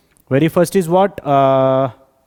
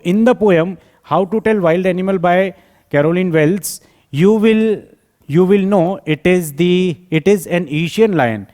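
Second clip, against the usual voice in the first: slow, raised opening with deliberate pauses; slow dictation; 4 semitones higher, much slower than usual. Acted. Teacher giving students the written answer, slow dictation. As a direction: dictate it phrase by phrase. Reads answer aloud slowly.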